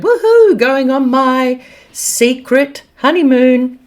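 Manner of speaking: high squealing whoop, then slow and sing-song; a hammed-up celebrity squeal. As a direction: gush like a giddy bride off on holiday. Mock [name] on private jet.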